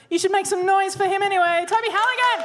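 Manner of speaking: high, rising announcer's shout-out; hyped-up introduction. Real, not acted. Host bringing on the last debater, high and hyped. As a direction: whip up the crowd, pitch climbing to the name.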